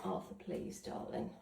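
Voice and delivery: unvoiced, soft coaxing; whispered plea. Real, not acted. Owner coaxing the dog outside, whispered plea.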